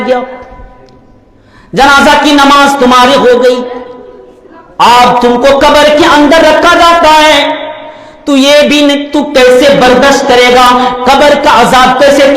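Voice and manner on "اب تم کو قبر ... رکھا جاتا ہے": normal talking voice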